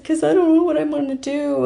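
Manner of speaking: high, tearful wail reliving the moment; imitating her younger crying self to her parents; retold crying. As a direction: say it like you're about to burst into tears. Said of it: "I start crying".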